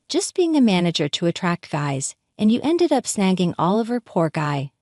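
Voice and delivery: plain reading voice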